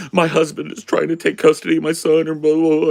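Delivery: high, loud mock whining; tearful babbling. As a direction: babble like a distraught waitress oversharing. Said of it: "She was just babbling her shit".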